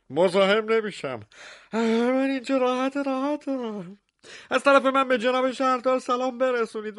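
high, muffled voice from inside a closet; muffled Dracula voice. Acted. Dracula refusing from inside the closet, muffled and high. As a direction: sound shut in and evasive, pitched high. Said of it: "صدای خفهٔ کنت دراکولا".

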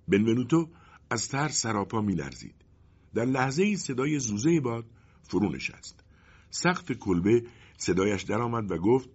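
usual speaking voice

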